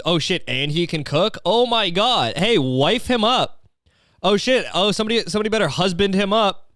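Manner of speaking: playful over-the-top astonishment; mock amazement. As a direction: hype him up in mock amazement. Acts out hyped reaction to cooking.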